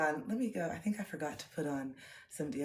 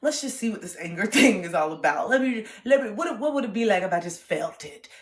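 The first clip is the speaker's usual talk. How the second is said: mock determined; 3 semitones higher, 10 dB louder than usual. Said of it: "when I finally said".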